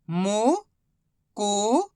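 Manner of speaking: मुकुट drawn out slowly as मु... कुट; stretched syllables. Shown as a demonstration. Teacher to pupils, stretching मुकुट slowly. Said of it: "slow syllable reading".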